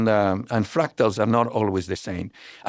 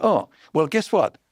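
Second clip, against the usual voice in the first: high-pitched mock grand announcement; playful self-important bragging; retold boast; 7 semitones higher than usual. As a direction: imitate someone proudly showing off, pitched well up. Retelling mathematician's boast to waitress.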